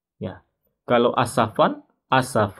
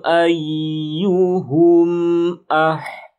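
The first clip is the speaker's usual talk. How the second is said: Quran recitation; 7 semitones higher, 8 dB louder than usual. Acted. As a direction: intone the verse with full tajwid. Recites full verse.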